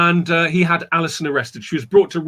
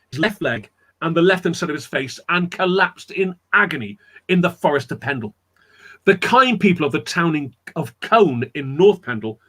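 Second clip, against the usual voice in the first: usual speaking voice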